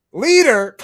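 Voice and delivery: loud, high mocking exclamation; scornful disbelief. Real, not acted. Streamer ridiculing someone's claim to be leader, scornful. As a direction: throw the title out high and loud in derision.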